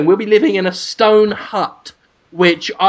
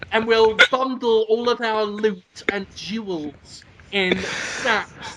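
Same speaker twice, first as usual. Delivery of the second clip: ordinary talk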